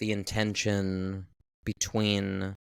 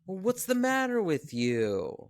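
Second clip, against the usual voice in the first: put-on quoting the boy; 9 semitones higher than usual. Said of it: "him saying".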